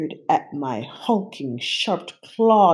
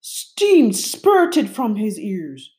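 ordinary delivery, nothing marked

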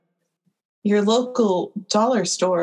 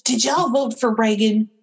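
ordinary talk, nothing marked

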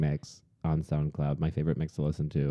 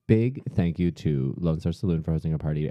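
usual speaking voice

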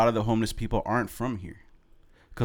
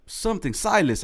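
retold scream; 11 semitones higher, slower than usual. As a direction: imitate a friend suddenly yelling out. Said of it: "he just starts screaming".

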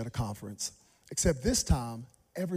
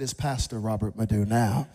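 lower, puffed-up correction; mock self-important; 6 semitones lower, 4 dB louder than usual. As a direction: act important. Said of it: "I said".

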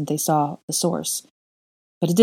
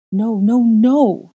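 rising from loud to shouted no; frantic building no; 6 semitones higher, 7 dB louder, much slower than usual. A narrator's reading. Wife pulling away from her husband, frantic shout. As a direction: resist louder each time. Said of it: "more and more frantic".

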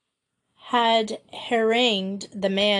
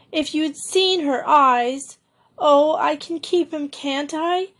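high, tearful rush of words; tearful pleading; 6 semitones higher, 3 dB louder than usual. A narrator's reading. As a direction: plead through tears to keep the pup. Girl crying after rescuing pup.

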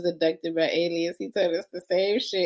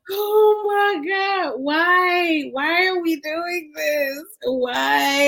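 exasperated outcry; 8 semitones higher, much slower than usual. Real, not acted. Exasperated reaction to crazy story.

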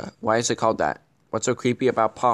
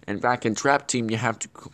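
ordinary talk

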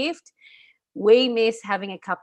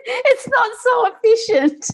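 laughing through the words; giggling, barely getting it out; 7 dB louder, slower than usual. Real, not acted. Joking to her co-host about slow Italian Post, laughing.

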